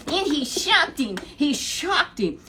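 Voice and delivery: slow, loud, high emphasis; emphatic. Real, not acted. Preacher stresses David's surprise attack, slow and loud. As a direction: land each word hard.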